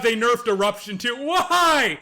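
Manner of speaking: normal talking voice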